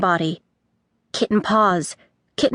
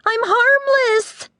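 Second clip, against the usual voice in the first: high, slow pleading yell; Pepper yelling; 14 semitones higher, slower than usual. A narrator's reading. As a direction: stretch it out to be heard. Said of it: "she yelled".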